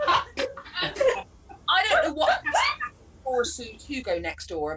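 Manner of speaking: ordinary delivery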